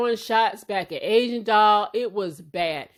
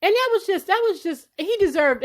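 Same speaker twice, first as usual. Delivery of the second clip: much higher and louder, fed up; exasperated outburst; 10 semitones higher, 3 dB louder than usual. Real, not acted. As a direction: spit it out in disbelief. Exasperated at T.I.'s remark.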